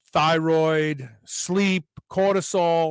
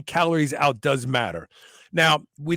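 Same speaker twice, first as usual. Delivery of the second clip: normal talking voice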